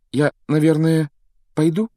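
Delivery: falter, then tentatively ask; hesitant stammer. A narrator's reading. Harry asks the unheeding wizard whether to leave, hesitant. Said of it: "запинаясь, спросил".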